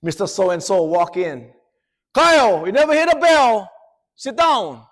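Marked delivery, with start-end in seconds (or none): 2.1-4.8 loud, high angry teacher; furious teacher ordering Kyle to sit; teacher yelling. Retold or imitated. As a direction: bark it at the student across class.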